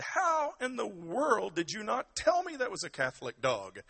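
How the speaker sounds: high, loud, hammed-up outburst; Father Patrick. Priest hears of the money, loud mock outrage. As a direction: exclaim as a flabbergasted parish priest. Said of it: "Father Patrick replied".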